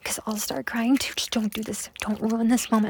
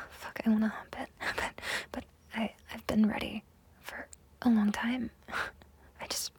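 normal talking voice

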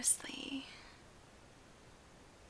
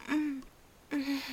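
loud moaning; 6 dB louder than usual. Acted. Moaning during intimate roleplay.

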